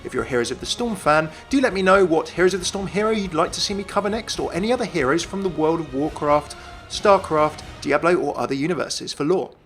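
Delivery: normal talking voice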